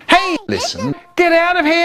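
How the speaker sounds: very high, squeaky little voice; funny voice. Acted. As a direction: pipe up in a tiny, urgent squeak. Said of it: "I hear a funny voice going".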